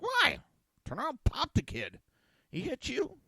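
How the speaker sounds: exasperated dad shouting disbelief; incredulous outburst. Retold or imitated. Dad tells daughter to hit the kid back, loud incredulous outburst.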